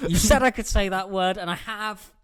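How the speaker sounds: loud, high-pitched gloating; triumphant. Real, not acted. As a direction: crow it loudly in triumph. Triumphant comeback after swearing.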